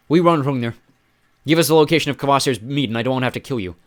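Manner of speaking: loud, hard demand; stern threat. Acted. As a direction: lean over him and threaten. Threatening the wounded giant.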